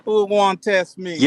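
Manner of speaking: slow, lightly voiced Jamaican gangster; Jamaican accent. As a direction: drawl it like a Jamaican don. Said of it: "my Jamaican accent".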